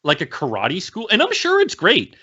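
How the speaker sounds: high, incredulous questioning; exasperated rant. Real, not acted. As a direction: protest with the voice pitched right up. Exasperated rant about Cobra Kai.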